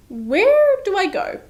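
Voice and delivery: high, drawn-out bewildered question; lost and puzzled. Retold or imitated. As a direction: act out looking lost, voice climbing. Acting out lost arrival at consulate.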